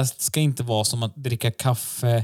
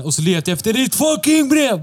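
mock shout; 10 semitones higher than usual. Podcast host mimicking the comedian's shouted punchline, mock yell. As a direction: yell the punchline the way she did, hammed up. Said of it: "Bara för att hon skriker".

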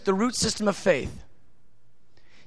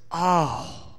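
lower, softer tender God voice; a hint of a delighted father; voicing God; 4 semitones lower, 5 dB quieter than usual. Preacher voicing God to a praying child, tender and low. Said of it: "He goes".

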